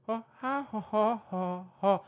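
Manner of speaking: loud, high ha ha hoots; mean it with all you have; earnest monkey call. Pretend. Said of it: "But mean it".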